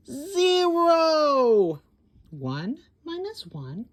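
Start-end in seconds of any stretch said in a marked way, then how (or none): 0.1-1.8 very high, loud call-out; excited answer. A narrator's reading. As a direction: shout the answer to the kids. Counting buttons left.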